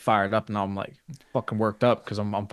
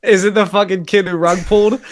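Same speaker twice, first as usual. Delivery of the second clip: laughing; 9 semitones higher, 8 dB louder than usual. Real, not acted. Laughing guess at friend's story.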